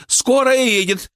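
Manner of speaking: high, drawn-out announcing call; crowd shout. A narrator's reading. Someone in the crowd announcing the ambulance, high stretched shout. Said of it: "закричал кто-то из толпы".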